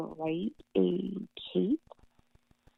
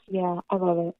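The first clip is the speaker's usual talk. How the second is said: ordinary delivery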